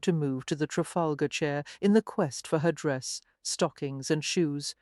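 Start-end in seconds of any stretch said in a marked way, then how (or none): none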